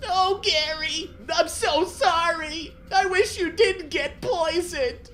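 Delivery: very high, loud, slow cartoon wail; tearful SpongeBob. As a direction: wail the apology in a squeaky voice. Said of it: "the tears started dripping out".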